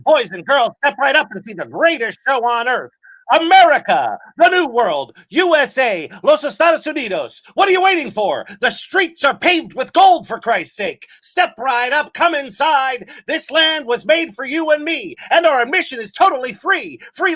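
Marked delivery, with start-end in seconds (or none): none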